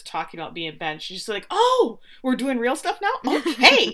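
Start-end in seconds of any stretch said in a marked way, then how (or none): 1.5-4.0 high, loud surprised "oh" with a laugh; exaggerated, squeaky-high surprised reaction; mock surprise. Keeley reacts to Roy suddenly opening up, mock surprise. Mimics surprise at serious turn.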